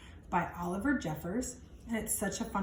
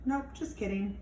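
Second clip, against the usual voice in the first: ordinary delivery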